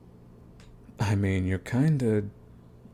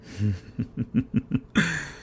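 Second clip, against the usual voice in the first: a high, drawn-out mocking laugh; gleeful taunting chuckle; amused villain laugh; 5 semitones higher, 4 dB quieter than usual. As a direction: laugh down at them, enjoying it. Villain amused at hostage's fate.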